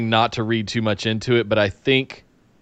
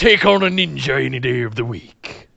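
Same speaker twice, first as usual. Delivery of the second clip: lightly voiced pirate swagger; a hint of a pirate boasting; pirate accent; 3 semitones higher than usual. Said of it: "was that your pirate accent right there?".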